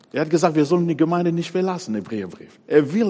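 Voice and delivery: ordinary talk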